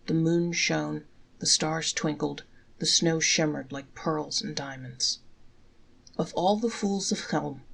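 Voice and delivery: ordinary delivery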